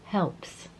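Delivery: ordinary talk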